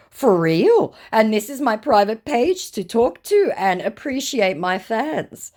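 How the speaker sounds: high, loud mocking scammer; hammed-up scammer voice. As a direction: read it like a smug fake celebrity. Voicing the scammer's messages.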